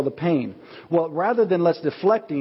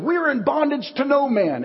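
mock-haughty, much higher and slow; proud denial; 10 semitones higher than usual. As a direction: puff up and deny it, slowly.

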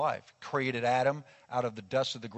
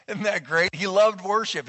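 laughing, higher and louder; laughing delight; 6 semitones higher, 5 dB louder than usual. Real, not acted. Preacher delighted by the boy loving worship, laughing. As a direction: laugh with real joy at the story.

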